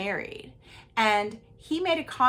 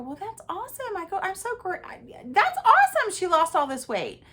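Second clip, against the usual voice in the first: high, sugary fake praise; sarcastic; 9 semitones higher than usual. Wife fake-praising husband's flirting, sugary sarcasm. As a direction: praise it sweetly, knowing he's caught.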